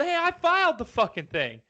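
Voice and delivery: high-pitched protest; mock protest. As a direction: whine that you filed it. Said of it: "And you're going".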